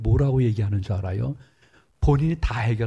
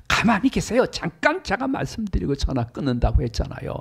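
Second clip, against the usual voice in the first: high, louder snapping shout to cut the pastor off; retold shout; 7 semitones higher, 4 dB louder than usual. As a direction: shout him down mid-sentence. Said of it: "소리를 지르면서 얘기하는데". (목사님 말을 끊으며 높고 크게 소리치듯)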